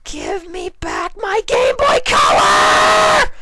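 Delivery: high, slow, furious shout; roaring demand. A narrator's reading. Fluttershy bursts into the library roaring at everyone. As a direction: roar it as she bursts in. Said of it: "Fluttershy roared forcefully".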